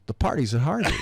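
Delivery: playful slogan read with a laugh; slogan punchline. Retold or imitated. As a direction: land the poster line as a punchline. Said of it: "so we made posters".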